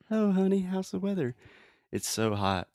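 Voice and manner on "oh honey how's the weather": high, sweet mock-mom voice; light impression of a chatty mother; imitating mom. Daughter imitating her mom asking about the weather. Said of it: "my mom".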